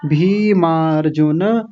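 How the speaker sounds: भीमार्जुन slowly as bhee-maar-ju-na; full final na. Shown as a demonstration. Teacher repeats the correct form, slow भीमार्जुन with full na. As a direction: stretch each syllable of भीमार्जुन. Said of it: "ऐसा बोलेंगे".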